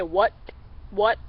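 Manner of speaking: "what" cut off sharply by the tongue; say what into the call. Shown as a demonstration. Showing students how to say "what" into a duck call. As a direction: clip "what" short, tongue stopping the air. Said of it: "say a what into it".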